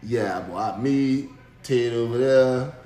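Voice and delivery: a hint of a smug guy bragging; smug rival. Rival bragging to the husband, mildly gloating and low. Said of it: "he looking at me like".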